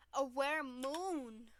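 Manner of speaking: A stretched long and slow; slow, drawn-out declaration. Shown as a demonstration. Friend announcing the moon choice, slow and grand. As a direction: savour each word like a grand reveal. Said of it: "I'm gonna go with the moon".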